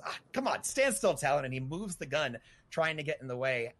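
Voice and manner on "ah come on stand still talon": high frustrated call to the target; exasperated shout. Acted. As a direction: yell at it to hold still. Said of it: "And then John goes".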